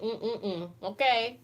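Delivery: ordinary talk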